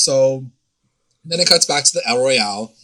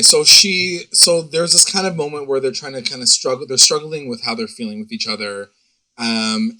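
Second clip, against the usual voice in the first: ordinary talk, nothing marked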